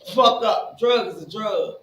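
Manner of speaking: high and loud, scoffing at the other drug user; sneer, then cut them off; mocking addict voice. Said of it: "You be like".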